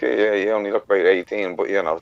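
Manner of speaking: ordinary delivery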